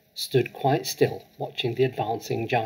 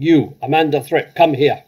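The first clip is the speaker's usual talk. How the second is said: very loud, higher, commanding roar; furious shout; 4 semitones higher, 10 dB louder than usual. Acted. Headmistress summoning a terrified girl, furious loud shout. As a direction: bellow the order across the yard. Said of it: "she was shouting".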